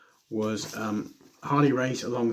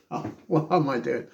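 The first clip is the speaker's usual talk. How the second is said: normal talking voice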